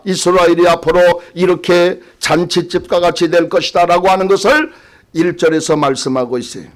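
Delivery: ordinary delivery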